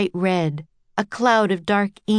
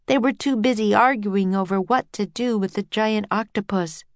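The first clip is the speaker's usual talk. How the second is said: ordinary delivery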